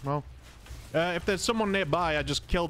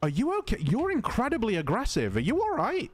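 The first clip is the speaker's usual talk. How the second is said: mock concern; 7 semitones higher than usual. Real, not acted. Mock concern for angry player.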